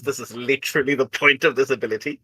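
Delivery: usual speaking voice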